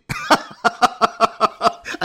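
imitated burst of helpless laughter; loud, cackling mock laughter; hysterical laugh. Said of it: "one girl laugh hysterically".

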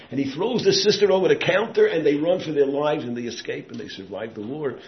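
loud, high preaching, fading down by the end; fiery preaching. Acted. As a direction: cry the rescue out to the crowd, then ease off. Preaching climax of a story.

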